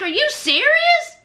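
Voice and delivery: mock disbelief. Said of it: "So he was like".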